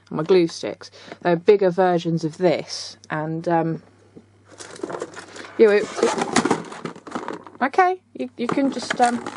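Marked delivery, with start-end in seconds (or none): 5.6-9.2 startled. Real, not acted. Glue gun suddenly attacks her.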